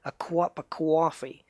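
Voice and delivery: slow New York or Boston "cwop a cwoffee"; Boston-style accent. Shown as a demonstration. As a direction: draw out an East Coast accent, W in cup and coffee. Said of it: "there's two W's that have".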